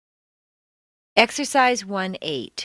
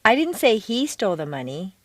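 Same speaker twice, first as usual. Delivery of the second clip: "he" lifted and emphasized; stress on HE. Shown as a demonstration. Teacher indicating another person took it, stress on "he". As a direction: punch "he" to clear that person. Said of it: "you don't think that he was the person".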